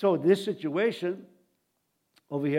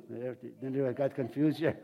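ordinary talk

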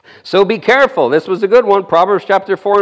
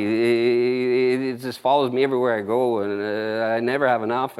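self-pitying moan; 9 semitones lower, 7 dB quieter than usual. Retold or imitated. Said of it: "you said".

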